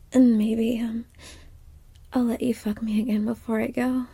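soft, hushed, teasing whisper. Acted. Sealing a secret deal with her lover, teasing whisper. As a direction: murmur it close and conspiratorial.

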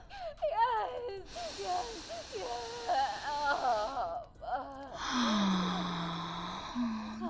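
long breathy sigh. Acted. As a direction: let out a long, slow sigh. Sensual moment.

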